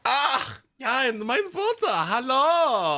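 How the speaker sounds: chatty eccentric woodsman, pleased with himself; lightly German-accented cheerful stranger; Albrecht the nudist. Acted. GM voices Albrecht NPC.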